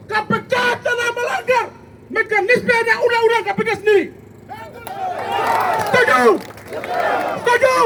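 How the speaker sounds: shouted protest chants, high pitched; rally speech. Real, not acted.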